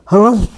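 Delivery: grumpy camel harrumph; camel snort. A narrator's reading. Camels grumbling at all the noise, snorted harrumph. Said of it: "snorted the camel".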